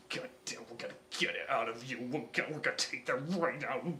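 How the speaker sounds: mock-angry low grumble; low growl. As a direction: grumble it angrily under breath. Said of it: "He's like".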